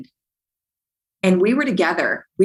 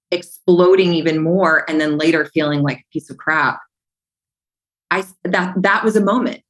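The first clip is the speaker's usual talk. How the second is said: ordinary delivery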